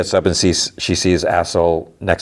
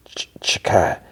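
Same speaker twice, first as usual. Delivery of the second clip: halting, voiceless whisper; imitated faint, stammered whisper; 3 dB quieter than usual. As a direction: whisper it as if barely able to speak. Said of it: "and he whispers".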